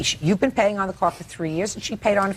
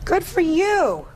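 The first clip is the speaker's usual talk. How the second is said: impressed; 9 semitones higher, much slower than usual. Real, not acted. Judge praises signed contract.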